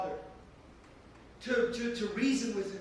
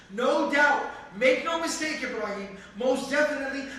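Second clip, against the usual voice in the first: loud, raised emphatic warning; emphatic threat; 2 semitones higher, 4 dB louder than usual. Acted. Father warning Ibrahim with heavy emphasis. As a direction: hammer each phrase home. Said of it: "with not one, but two degrees of emphasis".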